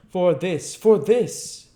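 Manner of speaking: impassioned repeated cry; rising plea. A narrator's reading. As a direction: build the plea, second one strongest.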